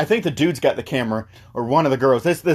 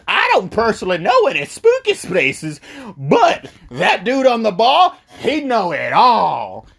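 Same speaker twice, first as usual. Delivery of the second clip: mock waitress voice; 8 semitones higher, 3 dB louder than usual. Waitress points friends to the dude at the bar, put-on voice.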